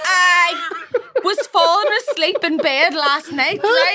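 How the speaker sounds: can barely get the words out for laughing; giggling storyteller fighting laughter; laughing while telling. Real, not acted.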